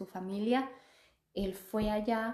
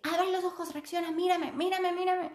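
high, urgent pleading to wake her; imitating a frantic boyfriend calling her back; begging her to open her eyes, pitched high; panicked boyfriend; 10 semitones higher than usual. Boyfriend yelling at dazed her.

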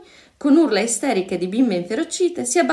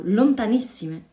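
plain reading voice

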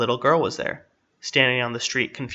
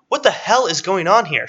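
outraged shout, high and loud; screamed; 8 semitones higher, 5 dB louder than usual. A narrator's reading. Police chief confronting his former employee, screamed. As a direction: yell it in exasperated disbelief. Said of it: "screamed the chief".

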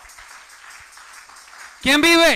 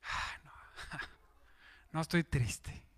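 soft, slow, breathy denial with a laugh; mock sad denial; 8 semitones lower, 18 dB quieter than usual. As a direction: deny being sad in a weak whisper. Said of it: "en lo triste". (negación débil y susurrada)

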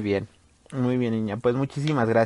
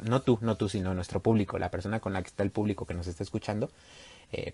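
ordinary talk

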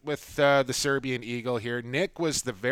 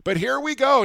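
usual speaking voice

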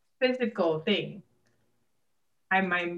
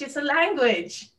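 high-pitched, worked-up insistence; mad, going off; 7 semitones higher than usual. Retold or imitated. Going off at party guests about Appalachian English, indignant. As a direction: re-enact an indignant rant, pitched up. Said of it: "I'm mad and I'm going off".

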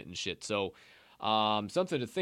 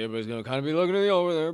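quick mock voice; 6 semitones higher, 7 dB louder, much faster than usual. Mimicking the betting public.

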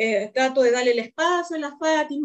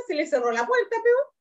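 ordinary delivery

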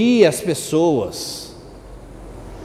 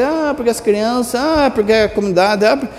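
mock whining; 4 semitones higher than usual. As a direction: imitate a defeatist moaning "ah". Mimics catechists' whining excuses.

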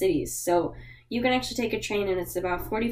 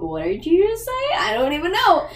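baffled listener; 7 semitones higher than usual. Retold or imitated. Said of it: "you'll be like".

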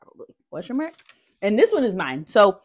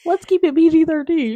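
talking through laughter; laughing; 9 semitones higher, 5 dB louder than usual. Real, not acted. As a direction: laugh while saying it. Laughing at coochie talk.

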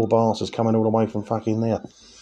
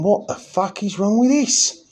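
high, slow, incredulous question; hammed-up horrified disbelief; mock baffled outrage; 10 semitones higher, slower than usual. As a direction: stretch it out, climbing in pitch, aghast. Bloke appalled by the pint.

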